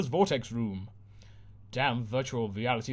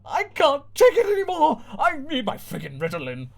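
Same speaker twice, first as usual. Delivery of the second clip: high loud shriek that falls back; 13 semitones higher, 7 dB louder than usual. Acted. Mum on screen cracking up, shrieking. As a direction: scream like a mum at breaking point. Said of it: "Mum shrieks".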